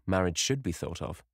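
usual speaking voice

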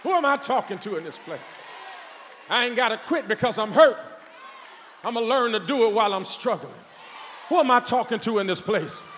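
loud, high, roaring sermon cadence; preaching at full force. Acted. Preacher rousing the congregation to be strong, shouting at full force.

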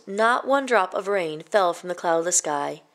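ordinary delivery

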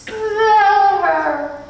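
high, loud, ringing order; Mrs Which's voice. A narrator's reading. Mrs Which telling Mrs Whatsit to explain, in a high, loud voice. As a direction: hand it off with a ringing command.

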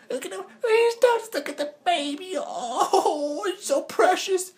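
very high cooing pet-talk, slow in places; high sing-song cooing at a cat. Real, not acted. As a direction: gush over the kitty.

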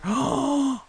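high gasp of delight; thrilled gasp. Real, not acted. Player saddling the horse successfully, thrilled gasp. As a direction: suck in a high, amazed breath.

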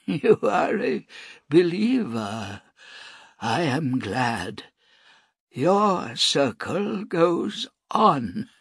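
high, slow, frail priest voice; a dying old man, slow and reverent. A narrator's reading.

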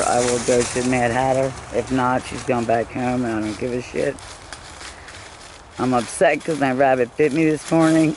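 ordinary talk

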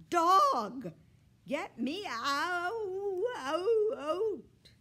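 loud, high, drawn-out wail; trapped cat yowling for rescue; desperate cries. A narrator's reading. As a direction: stretch the last word into a howl. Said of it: "Desperate complaints".

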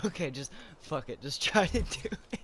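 frustrated. Real, not acted. Frustrated giving up.

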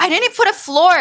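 high-pitched flustered realization; panicking. Real, not acted. Gamer racing a five minute build timer, panicking. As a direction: blurt it out in a light panic. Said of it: "I'm panicking".

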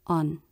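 "On" short, low and soft; unstressed ON. Shown as a demonstration. Teacher demonstrating pure unstressed ah, brief low soft "On". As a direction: let "On" drop quietly without stress. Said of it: "Pure unstressed ah".

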